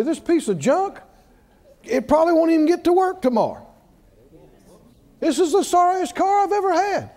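high-pitched mock griping; grumbling. Preacher imitating someone cussing their car, mock grumbling. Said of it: "when they're grumbling all the time".

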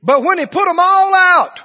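high, loud preaching; preaching at full force. Acted. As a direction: drive the story home at full voice.

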